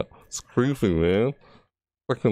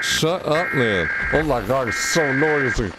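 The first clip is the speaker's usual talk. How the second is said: usual speaking voice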